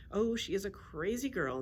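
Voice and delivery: ordinary delivery, nothing marked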